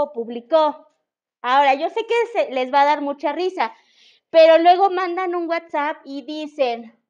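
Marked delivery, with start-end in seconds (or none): 0.1-0.9 "publicó" stressed on the final ó; publiCÓ, accent at the end; stress on last syllable. Shown as a demonstration. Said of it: "conocer los acentos".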